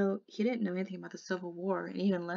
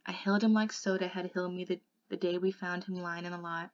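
ordinary delivery

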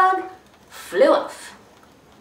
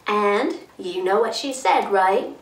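plain reading voice

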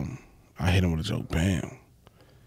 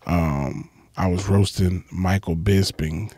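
ordinary talk, nothing marked

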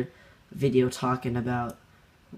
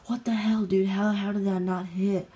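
high-pitched mock outrage; imitated raging gamer; 8 semitones higher, 3 dB louder than usual. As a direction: mimic a sore loser's incredulous protest, voice shooting up. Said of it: "he was still raging".

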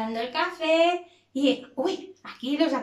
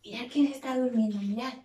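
normal talking voice

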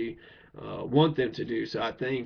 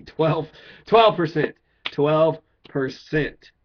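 louder and slow, each word punched; hammering twelve percent; 3 semitones higher, 7 dB louder, much slower than usual. Real, not acted. Ranting to viewers about Kentucky's twelve percent turnout, slow loud stress. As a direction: pound out twelve percent in disbelief.

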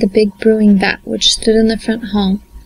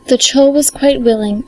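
ordinary delivery

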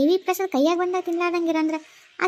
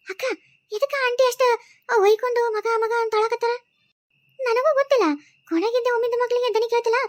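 a higher-voiced village woman, anxious and puzzled; worried neighbour; 9 semitones higher than usual. Neighbour hears the aunt crying and worries, high and anxious. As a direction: fret aloud about the crying next door.

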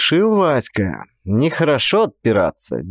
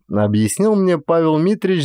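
ordinary delivery, nothing marked

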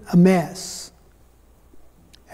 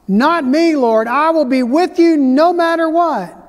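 Peter's vow; 6 semitones higher than usual. Acted. Peter to Jesus, swearing he'll stay, raised earnest protest. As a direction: insist you'd never leave him, voice raised.

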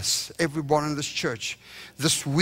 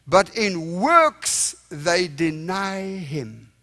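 slow emphatic; much slower than usual. Acted. Preacher stresses scripture slowly.

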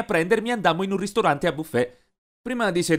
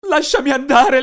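loud high desperate scream; terrified shout; 10 semitones higher, 8 dB louder than usual. Acted. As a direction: scream to be released. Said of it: "io urlai".

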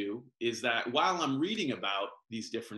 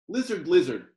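calling out; 6 semitones higher, 9 dB louder than usual. Acted. Calling to the lizard.

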